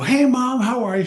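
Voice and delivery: high, loud hello to mom; imitating a perky visiting son or daughter; acted upbeat greeting. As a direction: greet mom with put-on pep. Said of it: "trying to change her physiology".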